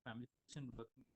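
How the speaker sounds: very faint, far from the mic; speaking far off the mic, faint; barely audible. Real, not acted. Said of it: "your voice is very faint".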